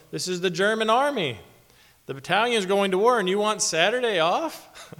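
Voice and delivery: mock bellow. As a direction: shout it like a furious lieutenant. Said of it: "he bellowed".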